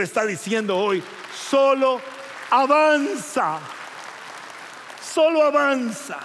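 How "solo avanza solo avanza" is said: loud, high, slow cry; booming shout. Acted. God urging his people to advance, a slow loud shout. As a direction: call it out like God urging forward.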